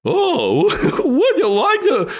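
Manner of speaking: high, slow squeak with a laugh; drawn-out squeaky question; Mad Hatter voice. Acted. Last try at the Mad Hatter voice, high and slow. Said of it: "Let me try it one more time".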